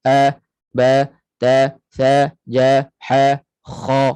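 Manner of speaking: A, ba, ta, sa, ja, ha, kha, each loud and clearly opened; A, ba, ta, sa, ja, ha, kha loud, same mouth opening; open mouth letters. Shown as a demonstration. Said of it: "Bukaan yang sederhana".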